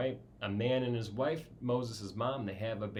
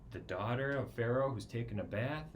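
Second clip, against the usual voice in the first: ordinary delivery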